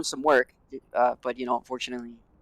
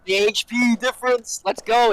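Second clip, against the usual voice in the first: high, loud hype call; excited; 9 semitones higher, 5 dB louder, slower than usual. Real, not acted. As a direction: hype the play up. Celebrating clutch play.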